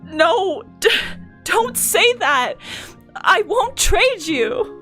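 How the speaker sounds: high, drawn-out, anguished pleading; slow, high-pitched desperate protest; desperate refusal. Acted. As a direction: plead in distress, stretching the words. Acted refusal to sacrifice friend.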